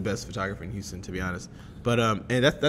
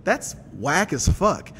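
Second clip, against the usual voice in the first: loud, higher, incredulous rant; raised voice, fed up and baffled; indignant outburst; 5 semitones higher, 4 dB louder than usual. Acted. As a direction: call out the whole crowd in disbelief. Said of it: "When I see that shit, I'm like".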